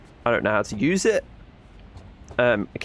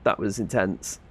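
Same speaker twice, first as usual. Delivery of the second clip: normal talking voice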